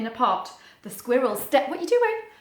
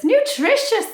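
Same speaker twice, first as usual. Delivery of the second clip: a little higher and louder squirrel line; lightly voiced squirrel; 10 semitones higher, 7 dB louder, slower than usual. Storybook squirrel agrees about soup, slightly higher voice. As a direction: chirp it brightly as the squirrel. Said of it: "said the squirrel".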